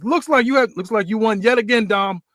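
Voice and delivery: high, loud trash-talking wrestler voice; cocky heel promo. Acted. Connor mocking the champ on the mic, cocky high heel voice. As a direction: sneer it at the champ like a smug heel. Connor gloating after the win.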